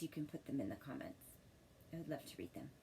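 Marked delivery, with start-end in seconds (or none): none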